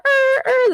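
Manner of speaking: high-pitched alarm beeps; imitated alarm noise. Mother copies her son doing the cartoon alarm, mimicked beeping. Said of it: "then he does the alarm".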